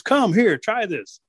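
high, eager summons; acted father's call; calling the children. Dad calls sons and daughters.

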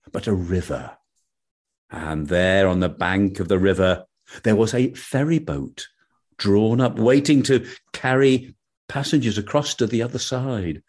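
ordinary delivery, nothing marked

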